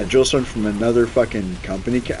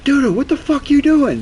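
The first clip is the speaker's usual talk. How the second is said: high, loud mock yell; loud high barked question; angry drill sergeant; 6 dB louder than usual. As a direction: yell it at a recruit. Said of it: "My drill sergeant's like".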